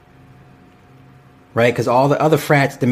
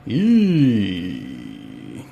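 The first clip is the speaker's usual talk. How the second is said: cringing eee; 6 semitones lower, 5 dB quieter than usual. Real, not acted. Cringing at the show clip.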